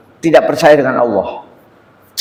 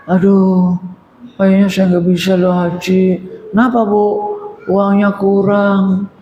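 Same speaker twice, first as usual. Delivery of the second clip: higher, small discouraged voice; timid mother; 6 semitones higher than usual. Retold or imitated. Preacher imitates a discouraged mother who fears she can't go on hajj. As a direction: sound like you've lost heart about the money. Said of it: "ciut, Pak".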